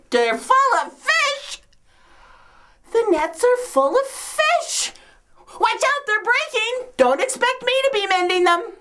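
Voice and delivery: high-pitched amazed outcry; astonished excitement. Acted. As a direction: exclaim in amazement, then fuss. Sees nets suddenly full of fish.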